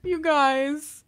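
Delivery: high, breaking into laughter; laughing apology. Real, not acted. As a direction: apologize while trying not to crack up. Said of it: "I think I'm laughing".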